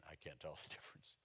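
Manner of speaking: usual speaking voice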